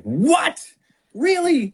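high, incredulous what, really; reacting to betting odds in disbelief, pitched high; mock disbelief. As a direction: squeak out the double take. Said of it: "was like".

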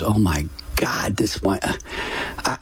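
slow, breathless whispered disbelief; a slow whisper of shock; stunned whisper. Retold or imitated. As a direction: barely voice it, as if the wind was knocked out of you. Inner thought on hearing of death.

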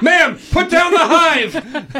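high, slow, loud command; mock shouted command. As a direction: bellow it like a frantic cop. Voicing deputy, mock command.

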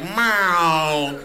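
long drawn-out meow; cat meow. Acted. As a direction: imitate a cat with one stretched cry. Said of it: "Cats meow once a month".

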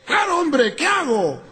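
high-pitched mock urgency; hammed-up frantic demand; comic exasperated caller. Frantic caller argues with fireman.

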